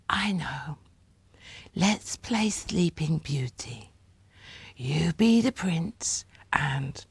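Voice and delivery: soft breathy whisper, slow. A narrator's reading. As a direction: whisper it like a dreamy child. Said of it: "in a faraway voice whispered".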